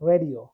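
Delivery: রেডিও with a clear initial r; R pronounced. Shown as a demonstration. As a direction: sound the r starting রেডিও. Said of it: "আর এর উচ্চারণ হবে". (র উচ্চারণ করে রেডিও)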